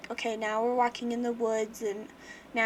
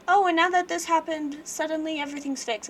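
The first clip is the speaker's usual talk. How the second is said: higher, louder opening on a mock revelation; mock breezy surprise; 5 semitones higher, 5 dB louder than usual. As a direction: toss off a too-easy happy ending. Mocks a too-easy story ending.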